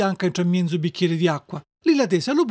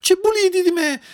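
high, panicky question; frightened cry; 12 semitones higher, 3 dB louder than usual. Acted. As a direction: cry out in alarm. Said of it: "gridò Pinuccio, sittandosi tutto scantato".

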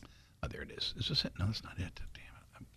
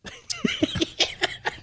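high squealing laugh; 6 dB quieter than usual. Real, not acted. Laughing at Diaz.

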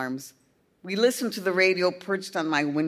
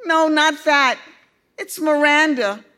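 put-on high, loud upset young boy; a boy wailing with dismay; distraught boy; 9 semitones higher, 8 dB louder than usual. A narrator's reading.

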